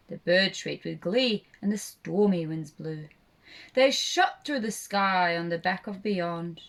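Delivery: usual speaking voice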